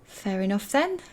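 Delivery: auntie. Acted.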